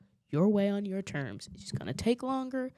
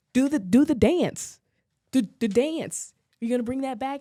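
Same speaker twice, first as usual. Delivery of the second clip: loud, insistent mock chanting; demanding fans; 4 semitones higher, 9 dB louder than usual. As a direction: pester like an impatient audience, stretching it out. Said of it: "everybody's like".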